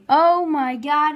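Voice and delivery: loud, slow shocked outburst; shocked at the moving blob; startled shout. Real, not acted. The candy swells and moves.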